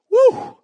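high loud whoop of delight; whooping woo. Acted. Preacher reacting to cantaloupe, whooping. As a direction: let out a big whoop.

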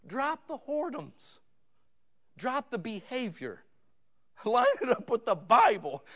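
high, pleading call to repent. Retold or imitated. Pastor begging a straying couple, high and pleading. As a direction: beg them to drop it, voice climbing high. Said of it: "I'm literally pleading out saying".

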